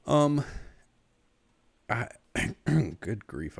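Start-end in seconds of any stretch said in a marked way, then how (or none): 2.3-2.9 sharp throat clear after choking on spit. Real, not acted. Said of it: "I was choking on spit".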